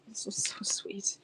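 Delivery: breathy whisper, barely voiced. Real, not acted. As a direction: hush it as if telling a secret. Cooing over a postcard.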